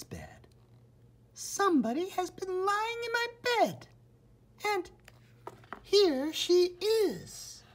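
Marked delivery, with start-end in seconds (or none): 1.3-7.6 much higher tiny cub voice, slowing at the end; a baby bear crying out he found her; little wee bear voice. A narrator's reading. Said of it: "cried the little wee bear in his little wee voice".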